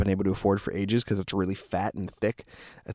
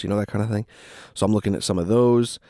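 normal talking voice

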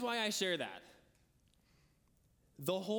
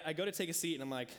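normal talking voice

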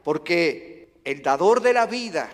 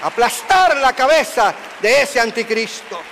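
loud, high preaching crescendo; fiery preaching; 7 semitones higher, 7 dB louder than usual. Acted. Preacher roaring to the congregation about the antichrist. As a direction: thunder it from the pulpit.

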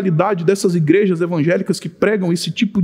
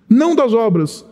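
ordinary talk

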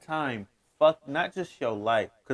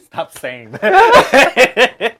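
laughing through it, high and drawn out; 8 semitones higher, 11 dB louder, much slower than usual. Real, not acted. Laughing as he begs his friend to stop. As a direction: plead while cracking up.

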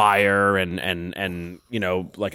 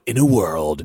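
movie trailer voice; 3 semitones lower than usual. Retold or imitated. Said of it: "stereotypical movie trailer voice guy person".

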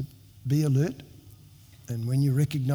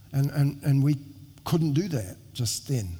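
ordinary delivery

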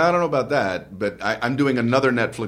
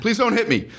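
laughing plea; 11 semitones higher than usual. Pretend. Jokes about the host hitting him.

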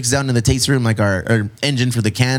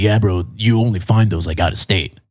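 lightly put-on voice, pitched lower; put-on bro voice; 4 semitones lower than usual. Quoting the man who brought the cans, put-on bro voice. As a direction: quote him in a playful lower voice. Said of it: "that's not how he talks, but I like doing that voice".

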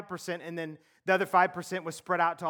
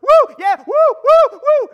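excited shouting woo; 21 dB louder, slower than usual. Retold or imitated. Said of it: "everyone's like".

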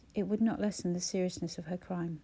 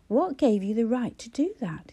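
persistent demand; 3 semitones higher, 6 dB louder than usual. A narrator's reading. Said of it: "he persisted".

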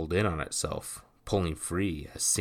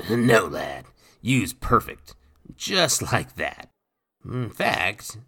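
lightly voiced gruff pirate, a touch louder; rough pirate voice; 5 semitones higher, 7 dB louder, slower than usual. A narrator's reading. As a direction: speak like a swaggering old sea rogue. Nathan voiced.

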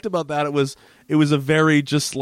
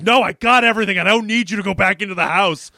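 mock angry yell, higher and louder; violent outburst; 8 semitones higher, 4 dB louder than usual. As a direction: act out him snapping at her. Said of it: "he, like, violently".

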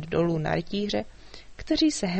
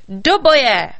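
loud charge shout; battle cry; 4 semitones higher, 12 dB louder than usual. A narrator's reading. A knight ordering the charge at the dragon, loud. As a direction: roar the order to attack.

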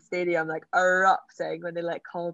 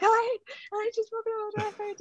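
high, sobbing cry of joy; imitating her dad weeping with joy. Dad sobbing the news to his wife, imitated. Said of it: "bawling his eyes".

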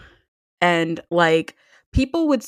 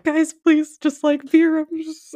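pleading; 9 semitones higher than usual. Retold or imitated. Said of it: "I turned to my friends".

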